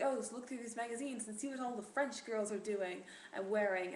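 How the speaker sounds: mock French grandmother voice; sing-song pretend French accent; put-on French accent. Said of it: "that accent".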